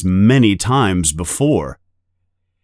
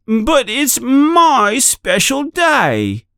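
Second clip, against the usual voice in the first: put-on very high, slow whining child; whiny fake cry; slower than usual. A narrator's reading. Dudley fake-crying to his parents, whining. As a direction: whine it like a spoiled kid. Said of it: "cries Dudley".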